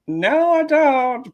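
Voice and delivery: very high, slow, drawn-out no; a strangled high protest; squealed mock refusal; squeaky no. Inner refusal under peer pressure.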